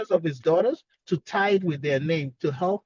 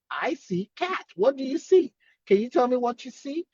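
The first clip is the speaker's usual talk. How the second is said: lightly acted teacher prompt, questions pitched higher; prompting a child; 7 semitones higher than usual. Therapist showing a child a cat picture, lightly acted prompting. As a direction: coax a child to answer.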